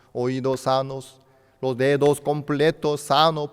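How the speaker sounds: put-on urgent prayer for the unborn child; retold prayer. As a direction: beg the Lord for the baby's health, rising loud. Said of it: "no pudimos menos que orar al Señor".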